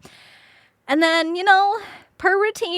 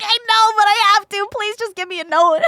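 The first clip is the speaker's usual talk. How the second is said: laughing plea; 7 semitones higher, 4 dB louder than usual. Retold or imitated. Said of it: "And I'm like".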